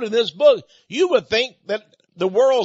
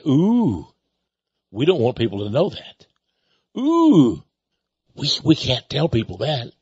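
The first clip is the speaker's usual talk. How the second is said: mocking whiny voice; 6 semitones lower than usual. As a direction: mimic them as sneaky and fretful. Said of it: "they say".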